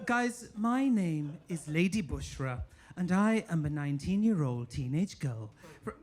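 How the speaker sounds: normal talking voice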